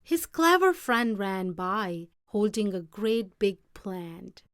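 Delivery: plain reading voice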